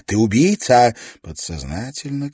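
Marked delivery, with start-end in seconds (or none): 0.0-0.9 louder accusing cry; accusing shout. A narrator's reading. She accuses him. (с обвинением)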